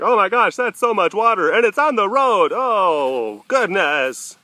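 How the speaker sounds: high, loud mock-alarmed viewer; mock viewer panic. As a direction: ham up a frightened onlooker. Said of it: "videos I've been posting, you know, like".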